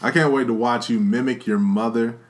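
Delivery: ordinary talk, nothing marked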